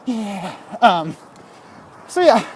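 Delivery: ordinary talk, nothing marked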